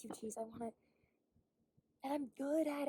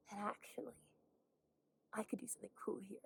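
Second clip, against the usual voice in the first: whisper; 8 dB quieter than usual. Real, not acted. Whispered hopeful aside.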